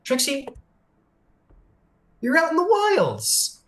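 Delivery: a hint of a cheery, encouraging spirit; lightly voiced guide. Spirit welcoming Trixie to the wilds, bright and encouraging. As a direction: lift up high on wilds, then ease off.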